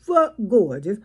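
ordinary talk, nothing marked

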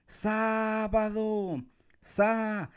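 high, drawn-out "Sábado" held on the Sá; stress on first syllable. Shown as a demonstration. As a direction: call Sábado, stretching the opening syllable. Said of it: "gritar en los tres lugares".

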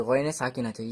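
normal talking voice

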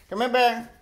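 ordinary talk

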